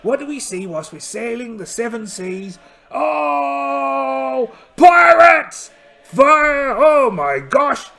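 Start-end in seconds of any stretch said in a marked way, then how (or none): 2.9-6.8 very loud, high shouted "pirates!" and "Fire!"; pirate battle shout. Acted. Captain spotting enemy ships, shouting the order to fire. 6.8-7.9 slow, loud drawn-out gasp of words; mock alarm. Captain under cannon fire, hammed-up alarm.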